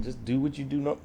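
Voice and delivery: usual speaking voice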